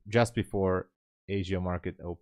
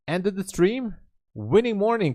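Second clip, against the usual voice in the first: much higher and louder, thrilled; real excitement, pitched way up; excited cheer; 11 semitones higher, 4 dB louder than usual. Real, not acted. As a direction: cheer a viewer's win. Reading a viewer's winning news.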